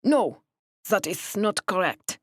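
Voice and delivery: sharp German no, then slow; firm German disagreement. Acted. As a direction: correct him firmly, German accent. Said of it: "she disagreed".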